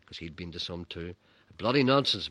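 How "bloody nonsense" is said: louder gruff army dad; grumpy dad. Retold or imitated. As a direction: scoff like an old soldier. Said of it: "my dad would murmur".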